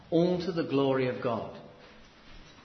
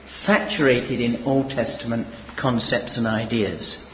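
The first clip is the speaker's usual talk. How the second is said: raised preaching voice; louder preaching; 2 semitones lower, 8 dB louder than usual. Acted. Preacher reviewing Paul's themes, raised preaching. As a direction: project it over the congregation.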